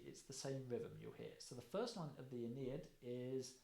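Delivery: normal talking voice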